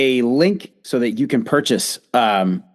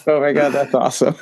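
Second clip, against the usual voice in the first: ordinary talk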